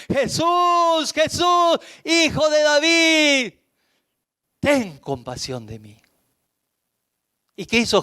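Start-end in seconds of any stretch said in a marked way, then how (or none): 0.1-6.0 much higher, desperate shout of a blind beggar; blind man shouting louder. Acted. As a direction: shout out over the crowd, even more urgent. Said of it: "con más fuerza gritaba". (el ciego gritando con más fuerza)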